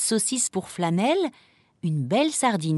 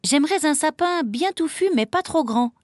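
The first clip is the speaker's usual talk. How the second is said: lightly voiced, higher girlish customer; Emma the young shopper; 9 semitones higher than usual. Emma ordering a tree from the merchant, eager. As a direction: politely place your order, pitched up.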